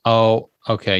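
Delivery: normal talking voice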